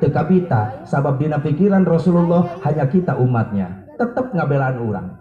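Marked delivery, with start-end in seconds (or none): none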